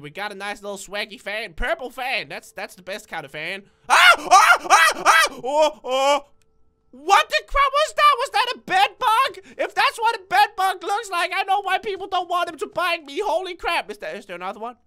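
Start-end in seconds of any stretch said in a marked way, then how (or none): 3.9-6.2 string of short, loud high yelps; startled screams. Real, not acted. Player sees a bed bug, startled screaming. Jump scare from creature. 6.9-13.9 high, loud, shaken exclaiming; alarmed outburst. Real, not acted. Player reacts to a bed bug, alarmed outburst. Shaken after jump scare.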